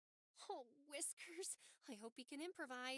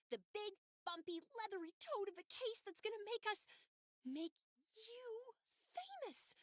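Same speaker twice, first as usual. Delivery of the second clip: excited; 7 semitones higher than usual. Acted. Excited pitch to boss.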